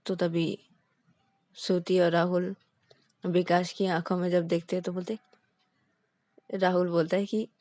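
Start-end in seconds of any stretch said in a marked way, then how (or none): none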